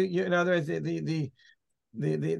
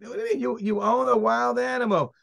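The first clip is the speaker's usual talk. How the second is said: higher incredulous retort; incredulous court; 7 semitones higher than usual. Retold or imitated. Court answers the owner's excuse, incredulous. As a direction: scoff at the excuse.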